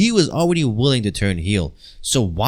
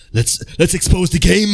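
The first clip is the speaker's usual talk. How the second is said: hype; 5 semitones higher than usual. Acted. Hyping up WWE Unreal exposé.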